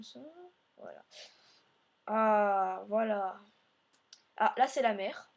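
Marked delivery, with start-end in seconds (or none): none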